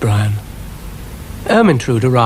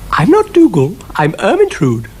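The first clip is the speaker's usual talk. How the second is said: rather proudly; 9 semitones higher than usual. Acted. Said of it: "rather proudly".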